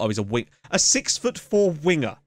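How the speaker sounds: ordinary delivery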